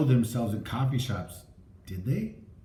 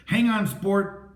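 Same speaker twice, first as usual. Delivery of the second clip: through clenched teeth; 6 semitones higher, slower than usual. A narrator's reading. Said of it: "he said through cl-, his clenched teeth".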